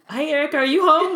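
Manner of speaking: high, loud sing-out to a friend; hammed-up worried greeting; mock anxious check-in. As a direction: call out squeakily, breaking into laughter. Acting out worried check-in call.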